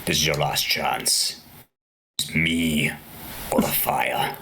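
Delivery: low, slow, menacing judge; deep threatening villain voice; Frollo voice. Retold or imitated. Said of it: "That voice is pretty cool".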